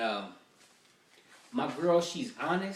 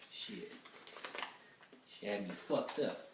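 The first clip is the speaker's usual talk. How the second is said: normal talking voice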